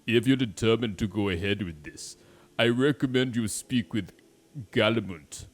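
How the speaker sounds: forceful put-on accent; accented game character.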